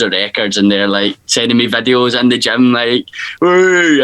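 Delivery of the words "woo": a quick, louder, higher whoop; mock cheer. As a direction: whoop like a hyped mate in the gym. Gym videos, cheering hype.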